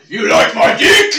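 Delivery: loud, high mock bellow; sudden shout. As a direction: drop the act and yell it out. Sudden switch from effeminate voice.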